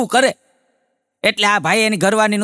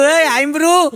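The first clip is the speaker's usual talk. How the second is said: calling out; 8 semitones higher, much slower than usual. Retold or imitated. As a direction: shout for the wife from the door. Husband calling his wife.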